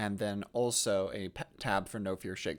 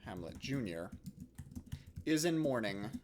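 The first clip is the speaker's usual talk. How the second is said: slow, halting dictation with long pauses; composing aloud; 10 dB quieter, slower than usual. Real, not acted. Teacher drafting a play summary for students, slow dictation. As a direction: dictate softly to yourself, phrase by phrase.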